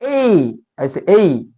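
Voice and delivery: ए drawn out slowly, falling from e into i; stressed letter A. Shown as a demonstration. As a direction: lean on the start of ए, then glide off as 'ey'. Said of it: "ए पर थोड़ा सा जोर होता है".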